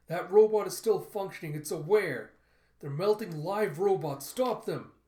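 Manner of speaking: high, very loud outcry; alarmed shouting. Acted. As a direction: shout for someone to stop it. Protester cries out in alarm.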